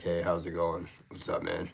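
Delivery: low, soft, laid-back greeting. Shown as a demonstration. Streamer showing he's no uppity TV Canadian, deep voice. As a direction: drop it down low and easy. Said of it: "my voice is a little bit deeper".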